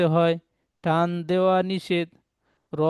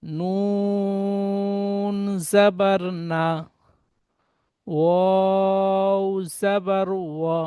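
each letter drawn out slowly and sung; slow teacher's chant of the alphabet; slow spelling drill; much slower than usual. Shown as a demonstration. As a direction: chant the letters with their vowel, stretching every name. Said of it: "তাহলে এখানে পড়বেন".

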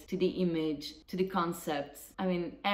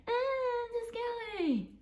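much higher dismissive mock lady voice; mocking the neighbour. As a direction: shoo her off high like the prissy neighbour. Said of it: "And she was like".

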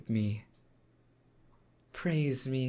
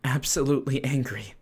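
louder, emphatic mock anger; acted fury; 3 semitones higher, 6 dB louder than usual. Demon announcing to a servant that they are furious, theatrically angry. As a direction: declare your fury with theatrical force. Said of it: "I am furious".

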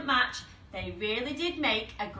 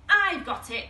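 lightly exclaimed, louder and higher; mild shout; 5 semitones higher, 6 dB louder than usual. A narrator's reading. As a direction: call out a sudden idea, half shouting. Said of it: "shouts Ted".

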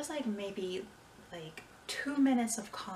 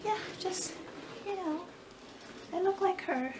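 high squeaky voice; 9 semitones higher than usual. Pretend. Joking about looking like Olive Oyl.

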